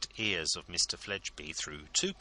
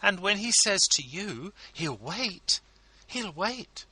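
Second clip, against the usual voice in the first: high, coaxing, ladylike entreaty; wife begging a favour for her husband, high and coaxing; pleading society lady; 14 semitones higher, 5 dB louder than usual. A narrator's reading. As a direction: wheedle him sweetly, much higher. Mrs Lammle voiced by narrator.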